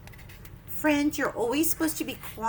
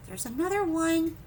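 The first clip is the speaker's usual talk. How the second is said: plain reading voice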